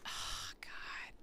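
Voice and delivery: breathless whisper, almost no voice; whispered oh god. Real, not acted. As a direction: breathe it out, moved. Overwhelmed by sad scene.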